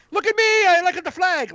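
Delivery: very high, put-on eager voice; desperate to look loyal; mock patriot. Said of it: "you're like".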